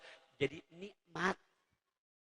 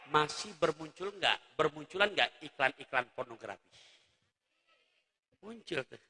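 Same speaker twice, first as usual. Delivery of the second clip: ordinary delivery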